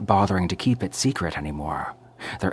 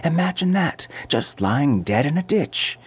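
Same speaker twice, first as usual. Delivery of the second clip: a big high swoop on that; scandalised excitement; 9 semitones higher than usual. A narrator's reading. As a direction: savour the scandal. Landlady relishes the news.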